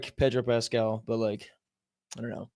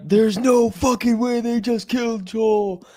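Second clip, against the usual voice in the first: high, slow, loud outraged disbelief; imitating angry dad; 8 semitones higher, 4 dB louder, slower than usual. Son retelling his dad's angry phone call, loud and outraged. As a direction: do the pissed-off dad, drawn out. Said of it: "He's like".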